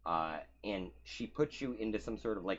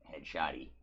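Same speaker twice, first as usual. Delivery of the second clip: usual speaking voice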